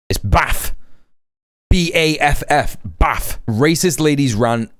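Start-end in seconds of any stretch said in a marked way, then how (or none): none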